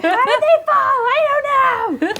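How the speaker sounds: very high loud goblin yelp; panicked goblin. Acted. GM voicing a flustered goblin, very high loud yelp. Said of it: "I'm like".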